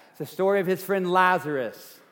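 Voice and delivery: ordinary delivery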